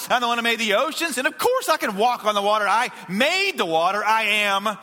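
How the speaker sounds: insistent, ringing sermon declarations; fervent preaching. Acted. Pastor declaring who Jesus is to followers, fervent preaching. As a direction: preach it with mounting conviction, hitting key words.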